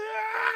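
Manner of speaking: high sudden pained yelp; mock scream. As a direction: yelp like he got burnt. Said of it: "He said".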